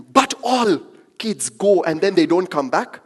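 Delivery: high, loud, pleading mother on the phone; pleading Indian mom. Retold or imitated. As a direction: beg your son to stay home, rising in pitch.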